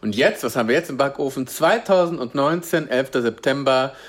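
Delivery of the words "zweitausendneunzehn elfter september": slow, drawn-out show announcement; announcer voice. Acted. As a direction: announce the menu slowly. (langsame Ansager-Stimme)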